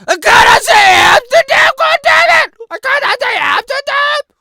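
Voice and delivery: hammed-up tantrum over a banned word; mock furious outburst. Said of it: "and then I could be like".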